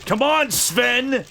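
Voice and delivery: high, slow, drawn-out plea; mock exasperated shout. Streamer goading Sven along, mock exasperated shout. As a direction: goad a lagging worker in jest. Urging game character on.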